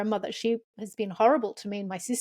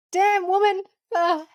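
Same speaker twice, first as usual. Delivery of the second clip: much higher and louder, a burst of being floored; moved exclamation; 10 semitones higher, 5 dB louder, slower than usual. Real, not acted. Host reacting to guest's powerful words, awed outburst. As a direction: blurt it out, struck by what she said.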